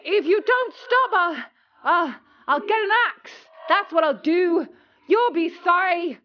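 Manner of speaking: high, loud, flustered threat; vexed threat. Acted. Frantic apprentice threatens the broom, high loud angry stammer. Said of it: "The boy was more vexed than ever".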